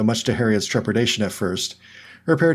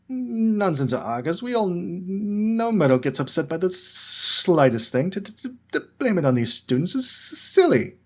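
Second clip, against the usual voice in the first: stuttering professor; 9 semitones higher than usual. A narrator's reading. Quirrell stutters defending students.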